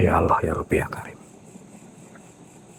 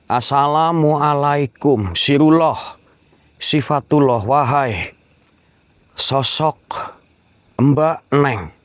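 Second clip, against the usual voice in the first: higher and slow, a drawn-out ritual greeting; calling out to a spirit; 6 semitones higher, slower than usual. Acted. Ghost hunter greeting the spirit woman, slow solemn call. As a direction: intone it like a summons into the dark.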